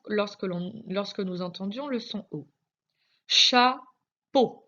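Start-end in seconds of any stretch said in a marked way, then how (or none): 3.3-4.6 "Chapeau" split loudly into two syllables, first one higher; découpé en syllabes. Shown as a demonstration. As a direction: say "Chapeau" syllable by syllable, with a clear gap. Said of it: "dans la deuxième syllabe". (« Chapeau » dit fort, syllabe par syllabe)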